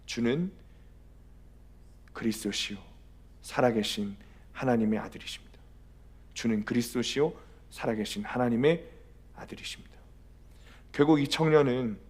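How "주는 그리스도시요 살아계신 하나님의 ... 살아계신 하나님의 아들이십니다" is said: softly profess it, phrase by phrase; understated martyr's declaration, fading to a near-whisper; retold confession. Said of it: "소리를 지르는 거예요".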